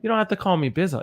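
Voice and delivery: normal talking voice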